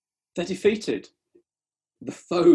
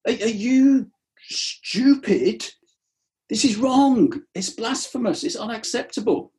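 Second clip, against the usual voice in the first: high, slow, scandalised scolding; mock the horrified Pharisees; outraged Pharisees; 9 semitones higher, 3 dB louder than usual. Pharisees berating Jesus, high and outraged. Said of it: "They were freaking out".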